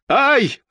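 high-pitched "ai" yelp; short high cry of fright; frightened yelp. A narrator's reading. Pale man on the fire ladder, frightened yelp. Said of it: "закричал человек с бледным лицом".

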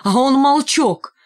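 rising, slowing indignation, pitch climbing high; a grandfather raging at the girl's silent tormentor; indignant outburst. Acted. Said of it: "Он так был возмущён".

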